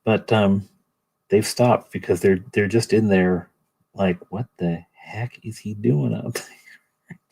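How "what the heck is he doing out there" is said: slow, hushed mock whisper; baffled whisper. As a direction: whisper it like baffled eavesdroppers next door. Imagined neighbours, baffled and hushed.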